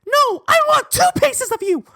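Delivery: very high, loud squeaky comeback; scrawny kid voice. Retold or imitated. Scrawny Sean answering the challenge, squeaky mock defiance. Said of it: "I remember he said".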